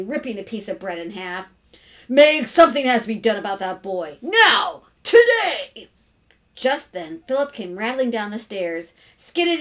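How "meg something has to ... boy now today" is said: escalating to shouted single words; angry demand. A narrator's reading. Father demanding his wife act on their son, angry. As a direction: build louder and higher to an ultimatum. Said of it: "said Mister Carmody angrily".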